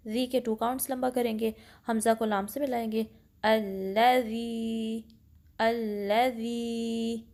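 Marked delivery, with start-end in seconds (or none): 3.4-7.2 अल्लज़ी recited slowly with ज़ी held for two counts. Shown as a demonstration. Teacher recites अल्लज़ी slowly for students. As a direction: draw out अल्लज़ी like Quran recitation. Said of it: "तो इसको ऐसे पढ़ेंगे".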